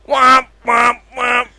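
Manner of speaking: high-pitched womps of a blaring horn; imitated car horn. Storyteller mimicking the neighbor's nonstop horn, imitated honking. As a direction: honk it like a stuck car horn.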